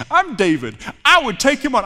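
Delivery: loud, boastful would-be hero; mock bravado. Preacher mimics listeners casting themselves as David, cocky. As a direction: puff up with pretend confidence.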